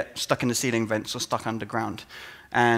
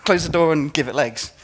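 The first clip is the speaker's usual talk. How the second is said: mock panic; 3 semitones higher than usual. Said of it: "Other times it's enough to go".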